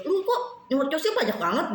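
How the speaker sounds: high, laughing mock husband teasing her. Husband laughing at how much she talks, high and teasing.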